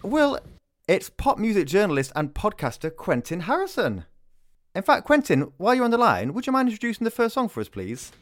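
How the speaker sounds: high, loud, fast gushing host; bright excited on-air welcome. Real, not acted. Excited host welcomes surprise caller.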